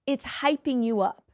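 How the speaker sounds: ordinary talk, nothing marked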